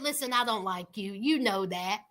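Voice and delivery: lightly voiced sister, low and soft; imitating the sister. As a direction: lay it down quietly, voice dropped low. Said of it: "And so the sister said".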